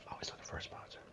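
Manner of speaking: breathy whispered aside. Real, not acted. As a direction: whisper it like a secret.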